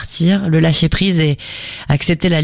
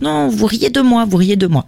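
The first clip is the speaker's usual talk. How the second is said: lightly imitated child protesting, pitched higher; offended child; 7 semitones higher than usual. Parent mimicking a daughter who feels mocked, mildly offended. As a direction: draw out a mildly wounded, higher-pitched complaint.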